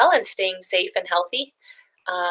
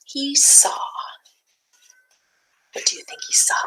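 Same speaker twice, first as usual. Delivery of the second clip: whisper; 7 semitones higher, 4 dB quieter than usual. Shown as a demonstration. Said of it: "teasing question to young listeners".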